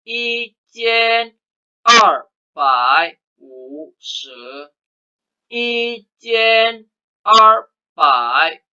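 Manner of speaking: I jian 二百五 十 stretched out very slowly; slow model reading. Shown as a demonstration. Teacher modelling one thousand two hundred fifty, very slow. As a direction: drag each syllable for the class. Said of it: "kita bersama-sama ya".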